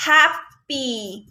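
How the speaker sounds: हैप्पी in two slow parts, final ई held; slow teacher demo of हैप्पी with a long ee; long E ending. Shown as a demonstration. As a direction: stretch the ई at the end of हैप्पी. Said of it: "long ई sound की तरह".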